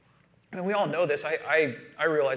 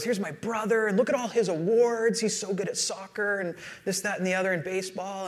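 higher-pitched imitation of a proud little girl; bragging young daughter; 5 semitones higher than usual. Daughter introducing her brother to a friend, bragging imitation. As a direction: rattle off boasts like an eager kid. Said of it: "She goes".

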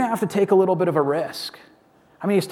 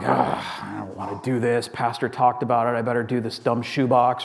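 begrudging; 7 semitones lower than usual. Retold or imitated. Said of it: "and you're like".

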